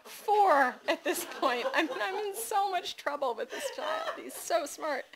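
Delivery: high, breathless laughing aside. Real, not acted. Mother marveling at her clever son, laughing. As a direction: laugh through it, delighted and helpless.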